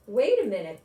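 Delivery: put-on boy hit by a bright idea; exclaiming. A narrator's reading. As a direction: blurt it out with a flash of excitement. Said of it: "Bobby exclaimed".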